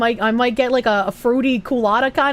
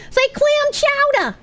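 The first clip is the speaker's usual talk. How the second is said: squeaky voice; 14 semitones higher, slower than usual. Retold or imitated. Reading out a request, high voice.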